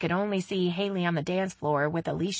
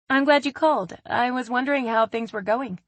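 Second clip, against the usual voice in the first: cheerful; 7 semitones higher, 3 dB louder than usual. A narrator's reading. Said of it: "she said cheerfully".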